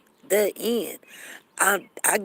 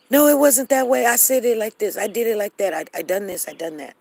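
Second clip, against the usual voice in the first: mock defensive protest; 5 semitones higher, 5 dB louder than usual. Imitating defensive arguer.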